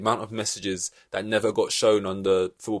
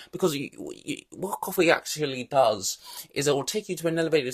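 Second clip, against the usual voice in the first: higher, fussy know-it-all lecture; nerdy numbers guy; 4 semitones higher than usual. Retold or imitated. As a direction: imitate the science-obsessed boss explaining caffeine. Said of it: "James Rice is straight on the shoulder".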